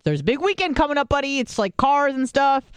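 condescending retold explanation; schooling someone. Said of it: "I'm, like, schooling him".

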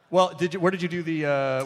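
usual speaking voice

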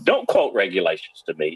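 usual speaking voice

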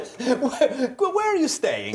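high, laughing mock Italian accent; mock Italian fan. Interviewer mimicking the Italian fan, mock Italian accent laughing. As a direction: giggle through a hammed-up Italian fan voice.

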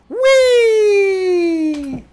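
long, high gleeful squeal; mock-gleeful drawn-out whee; childish whee. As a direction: squeal like a kid let loose. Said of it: "what you should have just typed".

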